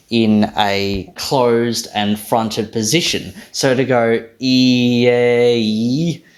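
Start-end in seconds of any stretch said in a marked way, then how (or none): 4.4-6.2 E, A, E drawn out in one glide; awkward vowel sequence. Shown as a demonstration. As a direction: slowly slide "ee-ay-ee" without a break. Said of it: "is just kind of awkward".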